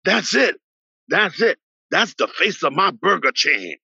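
high, eager mock Ali with a slow finish; lightly voiced champ, thrilled by the messy kid; excited Ali quote.

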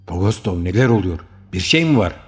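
alarmed calling out. A narrator's reading. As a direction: shout the questions in alarm. Calling to fleeing friend. (koşan arkadaşına telaşla seslenerek)

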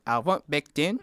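put-on Kiwi accent; New Zealand accent. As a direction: exaggerate the New Zealand vowels. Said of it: "doing a New Zealand accent".

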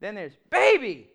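put-on "baby" said loud with a falling snap; loud scolding "baby" in the demo. Shown as a demonstration. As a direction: bark "baby" as a warning. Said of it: "they all mean something different".

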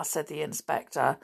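plain reading voice

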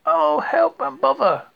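high, loud, slow lament; dismayed cry. A narrator's reading. Pooh stuck in Rabbit's door, high loud slow wail.

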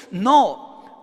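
ordinary talk

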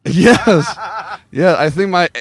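usual speaking voice

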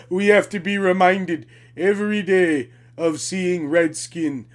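low, slow, put-on solemn voice; mock complainer. Mocking a complaining Native voice.

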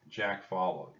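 ordinary delivery, nothing marked

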